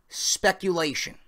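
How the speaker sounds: "speculation" said slowly with weight; slow, pointed "speculation"; stressed word. Shown as a demonstration. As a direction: lean on "speculation" deliberately. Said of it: "and I highlight that word".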